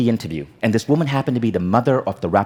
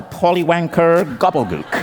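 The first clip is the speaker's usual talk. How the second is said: higher, drawn-out mock magic spell; spell incantation; 6 semitones higher, slower than usual. Shown as a demonstration. Speaker showing an audience how a British insult sounds like magic, spell incantation. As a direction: cast the words like a wizard's spell. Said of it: "It's like a Harry Potter spell".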